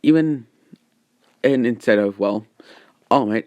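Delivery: ordinary delivery, nothing marked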